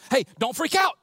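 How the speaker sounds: high, loud Southern exclamation; urgent Southern angel, calling out. Acted. Angel telling the visitors at the tomb, Southern exclamation. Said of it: "coming off the angel's mouth".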